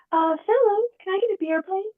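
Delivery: high, sugary polite ask; sweetest druid voice. Acted. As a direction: sweet-talk the guards. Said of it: "in my sweetest druid voice".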